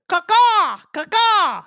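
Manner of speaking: loud high cawing bird call; crow caw. Shown as a demonstration. Bird noise imitation.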